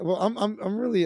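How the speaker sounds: ordinary talk, nothing marked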